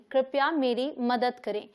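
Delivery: ordinary delivery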